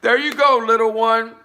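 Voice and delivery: high loud laugh into the words. Real, not acted. Laughing after reading the poem. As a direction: laugh it off brightly.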